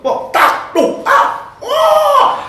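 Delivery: loud percussive taps into high yell; imitated knocks and yelp. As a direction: act out hammering, then a pained cry. Menirukan ketukan alat dan jeritan. (tiruan bunyi ketukan lalu jeritan)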